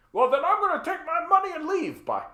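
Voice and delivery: a hammed-up petulant rich man; mock whiny landlord. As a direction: play the rich landlord storming off in a sulk.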